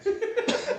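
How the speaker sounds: a high burst of real laughter. Real, not acted. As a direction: let a loud high laugh escape. Laughs after the punchline.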